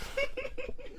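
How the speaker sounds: squeaky burst of giggles; high giggle. Real, not acted. Reader cracks up at clumsy fanfic grammar, giggling. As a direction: giggle helplessly at the line. Giggling while reading smut aloud.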